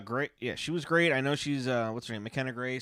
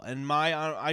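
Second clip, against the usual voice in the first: usual speaking voice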